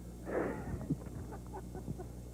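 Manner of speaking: breathy suppressed giggle; stifled laugh. Real, not acted. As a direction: try not to laugh and fail. Breaks up during countdown.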